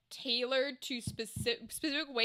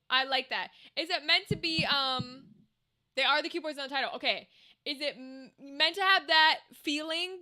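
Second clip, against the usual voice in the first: usual speaking voice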